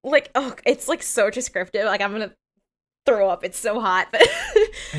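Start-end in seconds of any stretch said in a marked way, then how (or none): 4.1-4.9 loud high-pitched shriek of laughter. Real, not acted. Laughing to her friend about the hot scene. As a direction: burst into a squealing laugh.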